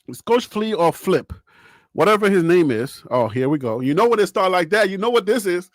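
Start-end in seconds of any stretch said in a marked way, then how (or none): none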